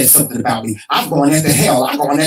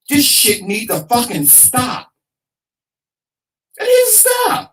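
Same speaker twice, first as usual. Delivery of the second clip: exasperated outburst; 9 semitones higher than usual. Real, not acted. Host begging listeners to stop feuding, exasperated outburst.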